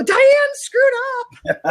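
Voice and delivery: high, loud, gleeful mock taunt; mock heckler. Imitating online crowds gloating over her slip, high taunting crow. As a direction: crow it like a gloating internet troll. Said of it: "everybody would go on the internet and go".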